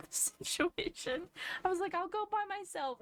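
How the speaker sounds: high voice, laughing through the words. Real, not acted. Remembering the prom mix-up: high laughing voice.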